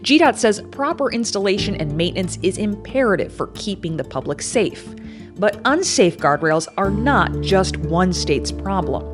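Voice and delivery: steady reporter voiceover; measured, serious news read; investigative news exposé. Acted.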